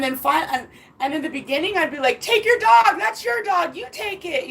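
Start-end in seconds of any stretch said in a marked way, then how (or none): 2.2-4.5 high-pitched mock defiance, fading in volume; retold defiant dismissal. Telling the ex to take his dog, retold defiance. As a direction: act out not caring one bit. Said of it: "trying to be like that".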